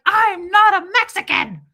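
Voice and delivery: imitated housekeeper's high, loud protest; indignant Lucy. Host imitating offended housekeeper, indignant shout. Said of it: "And Lucy's like".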